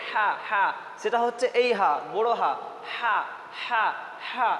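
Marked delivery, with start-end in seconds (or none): 0.1-0.6 হা with a breath pushed from inside the throat; from deep in throat. Shown as a demonstration. Teacher shows the big letter, হা from deep in the throat. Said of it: "গলার ভিতর থেকে". 2.9-4.5 হা with breath pushed from inside the throat; from deep in throat. Shown as a demonstration. Teacher drills the big letter, হা from inside the throat. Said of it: "গলার ভিতর থেকে".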